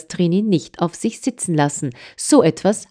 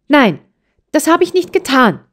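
put-on high, louder child's cry of protest; upset outcry; 10 semitones higher, 4 dB louder than usual. A narrator's reading. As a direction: burst out in hurt indignation. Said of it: "rief es aufgeregt". (aufgeregter Aufschrei, hoch und laut)

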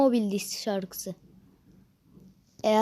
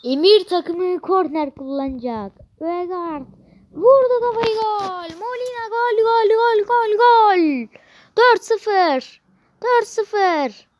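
higher, louder chanted goals; screaming football commentator; 8 semitones higher, 5 dB louder than usual. Pretend. Commentating corner goal.

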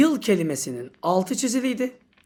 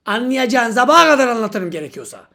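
usual speaking voice

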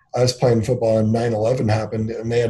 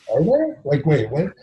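higher-pitched, baffled questioning; retold confusion; 5 semitones higher than usual. Husband retelling his wife's puzzling question, acting confused. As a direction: act out being thrown by a puzzling question. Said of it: "I was so confused at what she was asking me".

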